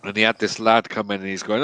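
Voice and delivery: ordinary delivery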